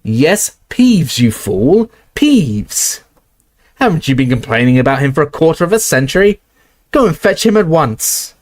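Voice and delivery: high, exasperated, quick orders; impatient scolding. Acted. McGonagall sends Filch after Peeves, exasperated. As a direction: rattle it off impatiently.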